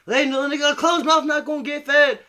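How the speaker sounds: high, loud imitation of his mom yelling; mom's voice. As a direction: belt it like a fed-up mom. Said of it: "she always told me".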